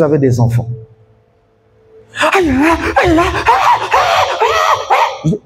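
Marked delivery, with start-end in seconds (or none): 2.3-5.4 high mock aïe-aïe wailing. Pastor mimics a shouting husband, high mock wail. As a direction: wail aïe aïe like a distraught spouse.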